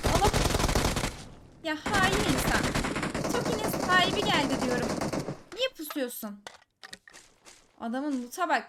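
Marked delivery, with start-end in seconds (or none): 0.1-6.4 outraged. Real, not acted. Killed by ambusher, outraged.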